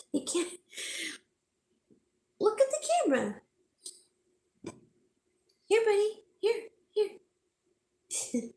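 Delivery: high, quick coaxing pet voice. Real, not acted. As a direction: coax the dog to look, high and bright. Coaxing dog toward the camera.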